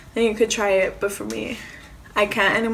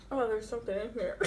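laughing; 8 dB quieter, faster than usual. Real, not acted. Laughing at her food.

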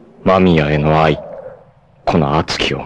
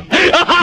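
short high scornful laugh; mocking high laugh. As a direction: sneer out a quick cackle. Villain mocks the hero.

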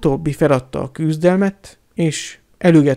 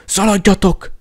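urgent shout; 6 semitones higher, 4 dB louder than usual. A narrator's reading. Sirius urges them to flee.

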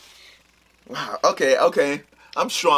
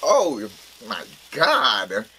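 disgusted; much slower than usual. Real, not acted. Rotten egg taste lingers.

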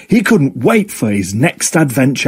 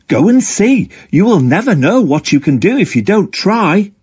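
higher encouraging friend voice; best friend cheering Octo on, higher; Jilly; 9 semitones higher than usual. Acted. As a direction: cheer him on, pitched well up.

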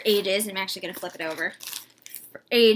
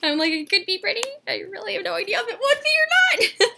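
high, giggling through the words; giggly; 11 semitones higher, 5 dB louder, faster than usual. Real, not acted. Vlogger teasing her own hat opinion, giggling high. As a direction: laugh at yourself as you talk.

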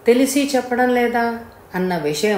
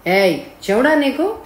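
higher-pitched, irritated question; angry challenge; 5 semitones higher, 3 dB louder than usual. Acted. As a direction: demand it crossly, with a rising end. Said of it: "అని అడిగాడు కోపంగా".

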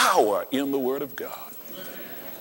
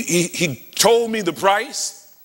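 ordinary talk, nothing marked

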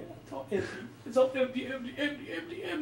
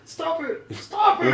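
mock pleading cry; 8 semitones higher, 4 dB louder, slower than usual. Pleading in game.

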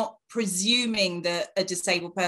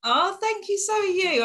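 much higher, bright thank-you; touched thanks; 12 semitones higher than usual. Real, not acted. Guest thanks the host for calling her sweet, touched and high. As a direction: lift your voice, genuinely touched.

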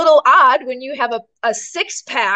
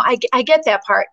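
normal talking voice

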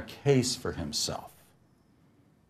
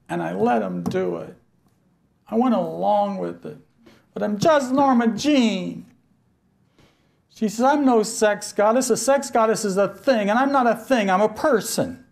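high, strained, near tears; a hurt woman insisting she is a person; tearful pleading; 11 semitones higher, 4 dB louder than usual. Retold or imitated. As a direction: plead high and tight as if weeping. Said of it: "If you hear, she's crying".

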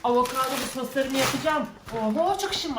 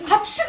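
hapşırma; 6 semitones higher, 5 dB louder than usual. Pretend. Şu anda benim sağlama tehdit.

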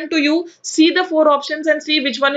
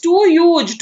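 "too huge" pushed out louder; emphatic, louder "too" before "huge"; stress on too; 5 semitones higher, 5 dB louder than usual. Shown as a demonstration. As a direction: lean hard on "too" in "too huge". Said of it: "is laying excessive stress".

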